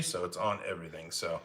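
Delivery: ordinary talk, nothing marked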